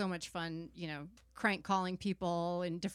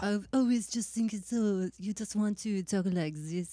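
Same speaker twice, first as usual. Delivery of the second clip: French accent; 2 semitones higher, 5 dB louder than usual. Shown as a demonstration. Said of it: "the French accent".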